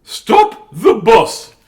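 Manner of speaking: high, loud, drawn-out exclamation; slow hammed-up teacher's shout; crying out. Teacher halting bus for sick child, crying out. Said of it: "Miss Glass cries".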